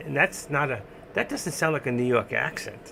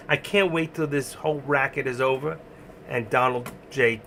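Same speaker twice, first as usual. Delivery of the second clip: ordinary talk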